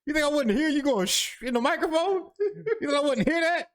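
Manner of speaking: high, loud laughing disbelief; incredulous teasing through laughter. Real, not acted.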